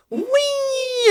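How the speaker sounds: long high-pitched squeal of glee; gleeful whee. Acted. Toddler throwing mum's ring out of the window, gleeful whee. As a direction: draw out a high "wheee". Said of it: "picked it up and gone".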